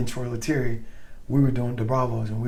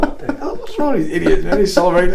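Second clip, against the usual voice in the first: high, louder laughing disbelief; incredulous; 7 semitones higher, 9 dB louder than usual. Retold or imitated. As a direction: scoff at the whooping idiots. Retold disbelief at celebrating soldiers.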